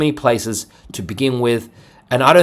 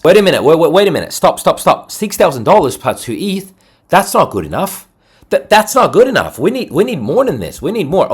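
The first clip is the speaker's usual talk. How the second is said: higher, louder put-on objection; mock indignant protest; 5 semitones higher, 5 dB louder than usual. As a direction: cut in with a hammed-up outraged demand. Acts out objecting to the prize.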